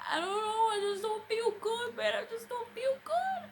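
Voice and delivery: high, crying whine, retold; imitating her own crying, pitched high; mock crying. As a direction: whimper it like a sick child in tears. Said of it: "I bawled like a baby".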